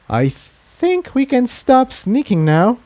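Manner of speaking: put-on relieved young mare voice; Tiptoe voice. A narrator's reading. Tiptoe's dialogue.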